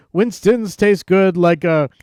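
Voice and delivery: put-on drawn-out old commercial slogan; Fred Flintstone. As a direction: imitate a cartoon character's cigarette ad. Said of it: "he does the whole thing".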